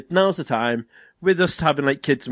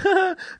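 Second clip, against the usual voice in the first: a sudden high giggle burst; burst of laughter. Retold or imitated. Laughing at the doctor's nose check, sudden high giggle. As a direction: let out one high, bright laugh. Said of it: "started laughing".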